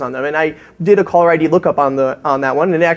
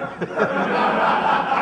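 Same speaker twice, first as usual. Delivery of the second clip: soft breathy laughter; stifled laugh at the prank; 3 semitones higher, 7 dB quieter than usual. Real, not acted. Speaker two feet from the pranked tourists, soft breathy laugh.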